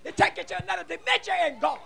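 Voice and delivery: high, urgent exhortation; fervent preacher urging the church to worship; full-force preaching. Real, not acted. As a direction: preach it hard, punching the key words. Preaches to congregation.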